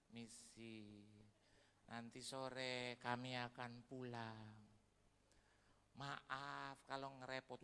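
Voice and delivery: slow, soft polite leave-taking; soft slow apology; humble guest. Retold or imitated. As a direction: apologize quietly and slowly.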